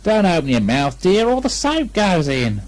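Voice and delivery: a hint of a coaxing mother; lightly voiced Kanga. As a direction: tease him gently. Kanga bathing Piglet.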